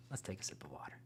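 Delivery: a whispered aside, half to himself. Real, not acted.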